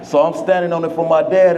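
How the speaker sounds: ordinary talk, nothing marked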